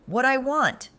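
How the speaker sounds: high, loud sneering echo; cold repeat. Acted. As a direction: repeat her words back coldly. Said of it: "he repeated".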